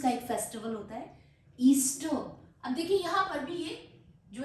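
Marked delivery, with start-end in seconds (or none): none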